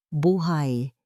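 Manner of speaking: ordinary delivery, nothing marked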